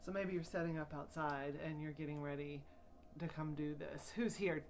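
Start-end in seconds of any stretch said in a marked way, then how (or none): none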